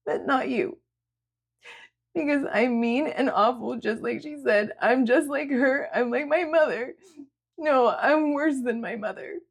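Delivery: high, upset voice near tears; self-loathing, fighting back tears; tearful young woman. A narrator's reading. Sierra berating herself to Julia, tearful.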